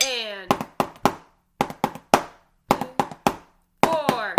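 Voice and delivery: loud drill count. Real, not acted. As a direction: call the count loudly over the drums. Counting the class in.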